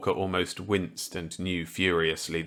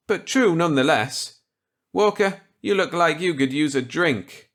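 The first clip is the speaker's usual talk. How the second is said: higher, louder voice for kindly Millet; Millet's voice; 9 semitones higher, 6 dB louder than usual. Acted. Millet offering Walker a gambit, kindly. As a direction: play the genial old scientist warmly.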